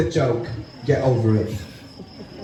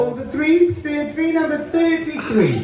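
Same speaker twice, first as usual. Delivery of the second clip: bingo caller; 5 semitones higher than usual. Acted. Calls bingo numbers.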